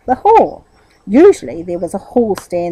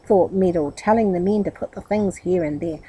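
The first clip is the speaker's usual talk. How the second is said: plain reading voice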